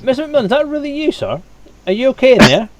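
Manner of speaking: high, loud worried call; guard calling out. Acted. Guard checks on the locked-in boss, calling loudly. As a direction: call through the door to the boss.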